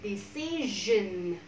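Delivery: "Decision" very slow, with a long final syllable; syllables drawn out. Shown as a demonstration. Teacher modelling three syllables for repetition, very slow. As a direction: stretch each part of "Decision" smoothly. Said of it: "C là âm tiết thứ hai".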